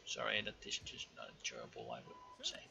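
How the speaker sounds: breathy half-voiced whisper, trailing off; whispered aside. Real, not acted. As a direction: drop to a whisper to complain. Aside on the navy battle.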